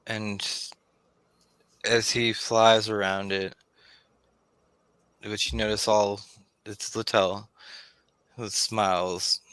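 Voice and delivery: normal talking voice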